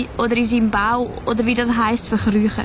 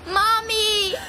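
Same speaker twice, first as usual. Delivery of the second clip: high call up to mother; child answering her mother's call, high; 12 semitones higher, 3 dB quieter than usual. Acted. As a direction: cry out for mom, pitched up.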